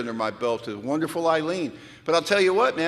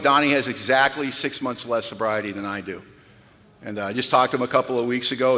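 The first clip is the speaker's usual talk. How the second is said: normal talking voice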